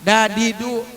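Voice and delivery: "Dadidu" with a light, front d; plain dal. Shown as a demonstration. Teacher contrasting letters, "Dadidu" with plain front d. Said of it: "Kalau du, dal".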